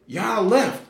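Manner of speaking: high, loud indignant outcry; an angry woman calling out the leaders for leaving; mad lady. Retold or imitated. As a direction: scold the leaders like a furious churchgoer. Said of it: "she stood up, she was mad".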